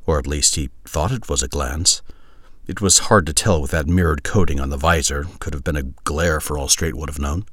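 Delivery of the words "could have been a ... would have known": ordinary delivery, nothing marked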